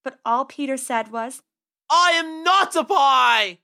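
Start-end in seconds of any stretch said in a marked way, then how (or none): 1.9-3.6 slow, loud, high defiant cry; defiant shout. Acted. Trapped Peter shouts.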